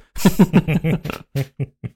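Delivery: amused falling chuckle. Real, not acted. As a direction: laugh at own mishap. Laughs at lava death. (leises Lachen)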